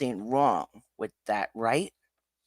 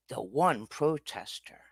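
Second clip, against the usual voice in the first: ordinary talk, nothing marked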